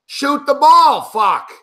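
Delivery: yelling at the screen for a shot; frustrated fan bellowing at the players; loud yell. Real, not acted.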